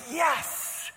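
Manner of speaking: thrilled yes, high and loud; overjoyed, pitch leaping up; delighted servant. Acted. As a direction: burst out grateful through a huge grin. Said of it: "he had this huge grin on his face".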